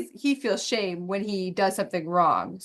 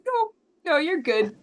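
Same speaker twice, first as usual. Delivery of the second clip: reassuring; 5 semitones higher than usual. Retold or imitated. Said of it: "I'm like".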